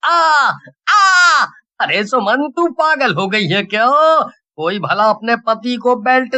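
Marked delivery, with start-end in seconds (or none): 0.0-1.5 high pained yelps under blows; pained cry. Acted. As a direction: cry out in pain as the belt hits. Husband beaten with belt. (Belt की मार से दर्द भरी चीख)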